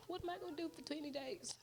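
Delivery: high, quick fretful question; retold dismay. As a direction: replay a mild panic, fast and high. Retelling her dismay at the wait.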